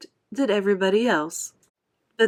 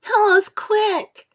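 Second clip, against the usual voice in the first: much higher, louder urging; eager cry; 14 semitones higher, 5 dB louder, much slower than usual. A narrator's reading. Earthworm crying to James for the plan. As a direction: demand it eagerly.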